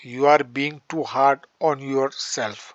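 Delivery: every word spaced out slowly for students; teacher reading an example sentence slowly to the class, dictating; slow dictation. Shown as a demonstration. Spell each word out slowly so the class can copy. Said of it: "teacher dictates example sentence".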